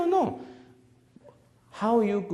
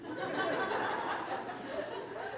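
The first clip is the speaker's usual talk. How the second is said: long, airy burst of laughter; a soft, breathy laugh at his own punchline; 11 dB quieter than usual. Real, not acted. Speaker cracking up at his meatball joke, breathy laugh.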